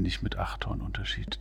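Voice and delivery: resigned half-whisper. Real, not acted. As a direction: drop to a whisper. Resigned aside, unvoiced.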